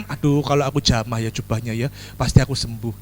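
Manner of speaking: soft, low musing to herself; quiet inner thought. Acted. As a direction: murmur the wish privately. Woman's inner thought voiced. (bisikan batin pelan)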